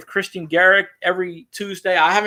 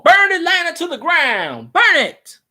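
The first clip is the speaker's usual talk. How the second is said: high, commanding war order; General Sherman; 10 semitones higher than usual. Retold or imitated. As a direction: bark the order to burn the city. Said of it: "like General Sherman in the Civil War".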